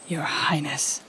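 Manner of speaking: whispered mocking title. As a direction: breathe Your Highness out unvoiced. Whispered taunt to royal listener.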